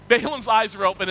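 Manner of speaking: normal talking voice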